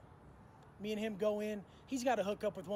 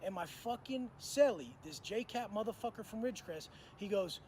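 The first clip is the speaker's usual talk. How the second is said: ordinary talk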